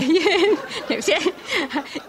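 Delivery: high giggling laugh. Real, not acted. Laughing mid-joke.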